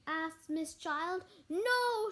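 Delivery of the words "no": loud and much higher, a group yell; shouted no. A narrator's reading. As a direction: shout it out as the girls. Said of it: "shouted all the girls".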